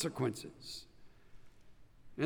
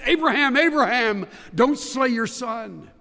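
louder and higher, calling the name twice; urgent divine call; 6 semitones higher, 7 dB louder than usual. Acted. God to Abraham, an urgent raised call. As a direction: call out like a voice from heaven stopping a hand.

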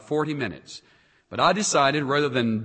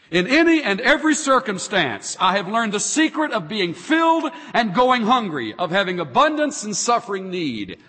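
raised and ringing, building to a peak; preaching the scripture; 8 semitones higher, 5 dB louder than usual. Acted. Pastor proclaiming Paul's prison letter, at rising full force.

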